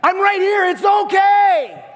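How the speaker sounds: high, loud drawn-out shout; screaming over chaos. Acted. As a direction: bellow reassurance over the howling wind. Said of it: "He's screaming over the chaos".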